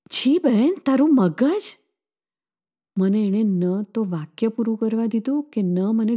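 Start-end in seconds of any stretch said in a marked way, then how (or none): 0.1-1.6 shocked rebuke. A narrator's reading.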